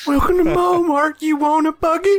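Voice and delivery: Walmart greeter. Acted. Player mocking the squad as store greeters, high greeter voice. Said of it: "made up of Walmart greeters".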